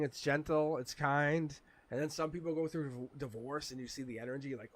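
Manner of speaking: normal talking voice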